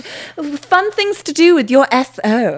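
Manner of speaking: slow, mock-chic magazine headline; fashion magazine voice. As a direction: read it like a high-end fashion mag. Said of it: "high-end fashion magazine".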